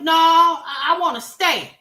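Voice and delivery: high, loud insistent answer; hammed-up woman insisting; high mock woman. Voicing Wineglass.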